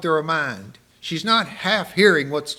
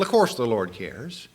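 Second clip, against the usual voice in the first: ordinary talk, nothing marked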